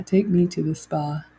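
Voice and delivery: ordinary talk, nothing marked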